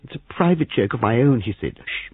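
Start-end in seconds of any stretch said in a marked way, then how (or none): 0.0-1.4 high, giddy voice; giddy amusement. A narrator's reading. Young man still amused at private joke, giddy. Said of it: "Christopher was still in fits of laughter". 1.8-2.1 soft, drawn shushing; hushing. A narrator's reading. Young man silencing the room, hushing. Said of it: "He put his finger to his lips".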